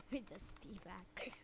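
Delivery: slow hushed whisper of "We just teabag". Shown as a demonstration. Gamer whispering after teabagging an opponent.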